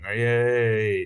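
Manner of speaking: drawn-out mock "yay"; sarcastic cheer. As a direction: cheer it half-heartedly, with irony.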